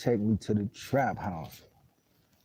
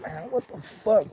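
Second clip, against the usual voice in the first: high, slow mock outrage at the smell; retold disgusted shock; 9 semitones higher, slower than usual. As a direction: replay the shock of walking into the smoke. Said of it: "So I'm like".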